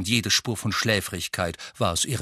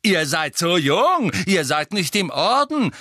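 high, loud, shrill mock-mother voice; shrill mimicry of mother; 9 semitones higher, 5 dB louder than usual. A narrator's reading. Twin parroting his mother's refusal, shrill mimicry. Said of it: "mit schriller Stimme, die unverkennbar nach der seiner Mutter klang".

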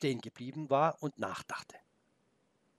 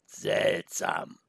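wolf voice; 4 semitones lower than usual. Acted. Wolf remarks.

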